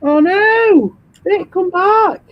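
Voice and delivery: high, drawn-out fake pleading; hammed-up wail, slow and high; mock dismay. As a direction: call after them in put-on distress. Mock dismay at Vic having left.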